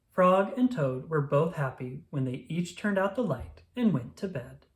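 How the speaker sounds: usual speaking voice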